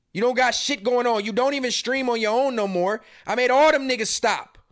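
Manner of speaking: normal talking voice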